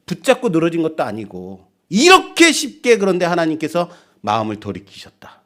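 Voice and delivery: normal talking voice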